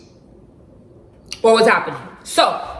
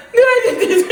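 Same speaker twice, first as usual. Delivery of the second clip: frozen uncertainty; 10 semitones higher, 3 dB louder than usual. Retold or imitated. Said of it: "I was like".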